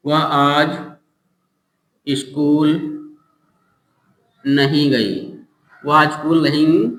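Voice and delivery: long pauses between words; feed it word by word for writing; slow dictation. Shown as a demonstration. Teacher dictating a sentence to students, slowly. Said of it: "लिखो".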